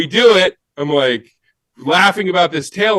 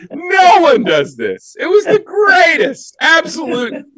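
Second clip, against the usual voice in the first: high-pitched, shouted delight; real bursting enthusiasm, pitched way up; excited exclaiming; 12 semitones higher, 3 dB louder than usual. Real, not acted. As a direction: exclaim it in gleeful amazement. Delighted punchline after acting out story.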